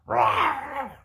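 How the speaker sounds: squeaky high playful rawr; mock growl. Reviewer mocking the hero's snarl with a squeaky rawr. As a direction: tease the snarl with a cartoon growl.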